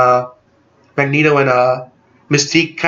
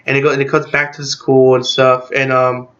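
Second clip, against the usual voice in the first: ordinary delivery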